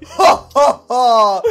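loud fake staged laugh; mock laugh. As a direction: bark out a hammed-up ha ha ha. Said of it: "I kept on going".

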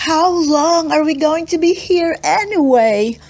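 high, squeaky small voice; little mouse voice. Acted. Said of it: "squeaked Smokey Joe in her not quite so mean little mouse voice".

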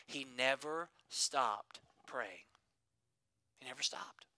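slow, soft, fading to a whisper; hushed. Real, not acted. Pastor to congregation, sinking into a whisper. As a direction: draw them in with a whisper. Pastor drops to intimate hush.

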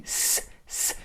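"S" as a voiceless hiss, very soft; teacher sounding out a letter; letter sound only. Shown as a demonstration.

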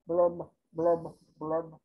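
low, soft blurb sounds; glum bubble blurbs. Acted. Pouting fish in the ocean, low glum blurbs. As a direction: sink into sad bubbles.

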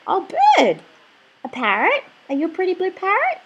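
usual speaking voice

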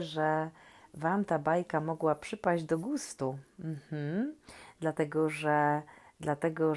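ordinary delivery, nothing marked

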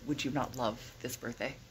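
ordinary talk, nothing marked

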